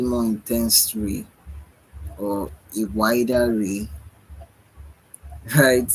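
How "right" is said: laughing. Real, not acted. Laughs at own idea.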